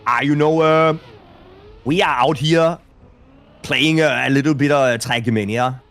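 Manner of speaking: a hammed-up attempt at a Danish accent. Shown as a demonstration. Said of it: "I think that's the best Danish accent".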